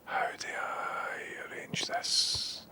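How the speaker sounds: slow whisper to himself. Real, not acted. Streamer puzzling over his audio setup, whispering slowly. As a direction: mutter it under your breath, slowly. Fiddling with audio settings alone.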